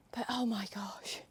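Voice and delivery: slow, hushed, near-whisper; shaken aside. Real, not acted. Rider to viewers about her scary horse, hushed aside. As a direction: breathe it out slowly under your voice.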